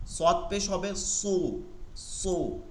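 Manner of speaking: সু slow and thick; heavy sad damma. Shown as a demonstration. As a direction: say সু full and heavy. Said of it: "মোটা". (ধীরে, মোটা করে সু)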